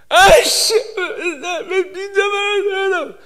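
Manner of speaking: high, hammed-up wailing prayer; acted bawling in bitter frustration; mock sobbing tirade, breaking into whimpers; crying complaint. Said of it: "막 이러고 울었어요".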